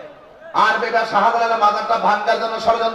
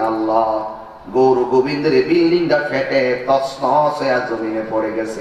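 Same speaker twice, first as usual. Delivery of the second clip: low, solemn voice of the saint calling on Allah; saint calling on God; 9 semitones lower than usual. Retold or imitated. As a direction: call out to God in deep devotion. Saint's prayer voiced low in story.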